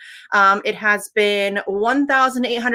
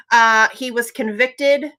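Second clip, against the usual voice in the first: usual speaking voice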